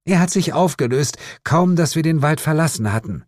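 high-pitched, insistent woman's role; defensive mother. A narrator's reading. Mother explains the vanished fog to detective, defensive. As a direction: justify the story, a little affronted.

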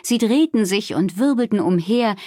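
ordinary delivery

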